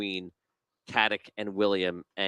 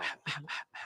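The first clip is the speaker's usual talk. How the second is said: soft, breathy chuckle; hushed, voiceless snicker; quiet laugh; 4 semitones higher, 12 dB quieter than usual. Real, not acted. Game master laughs.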